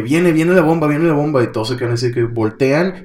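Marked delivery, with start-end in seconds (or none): 0.0-1.4 higher, louder mock yell; half-acted panicked shout; retold panicked yell. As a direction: yell the warning like a panicked girl. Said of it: "Bim empieza a gritar otra vez".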